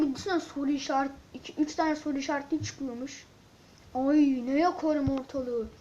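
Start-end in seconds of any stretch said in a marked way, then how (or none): none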